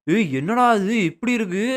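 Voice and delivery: high, drawn-out disbelief; slow, rising-pitched amazement; astonished. Real, not acted. Startled by creature appearing in game.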